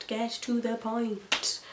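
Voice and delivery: slow, laboured mock exasperation; drawn-out impatience. Viewer fed up with slow episodes, exaggerated slow impatience. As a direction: GET TO THE POINT stretched out slowly, word by word. Repeats complaint, drawn out for emphasis.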